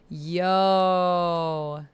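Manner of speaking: normal talking voice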